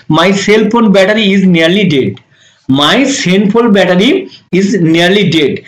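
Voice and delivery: each word slow and clear; slow model sentence. Shown as a demonstration. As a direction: read it out slowly for the class. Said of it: "এটা আপনি কিভাবে বলবেন?". (ধীরে, স্পষ্ট করে বলা)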